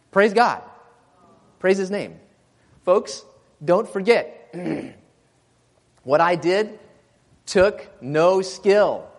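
high, loud preacher's call; fervent preaching. Acted. Preacher urging the congregation at full force. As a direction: preach it out to the folks.

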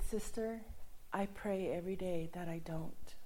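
an intimate whispered admission to the sister. Retold or imitated. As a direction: whisper it to her like a secret. Confides to the lady, holding hands.

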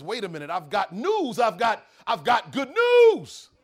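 loud, slow push of good news; urgent insisting. Acted. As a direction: shout over him to be heard. Governor insists he has news.

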